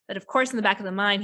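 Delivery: ordinary talk, nothing marked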